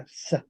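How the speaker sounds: "S" as a soft hissed sound; voiceless s. Shown as a demonstration. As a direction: say "S" as sss, not the letter name. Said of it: "El español cambia". ("S" como s sorda, suave)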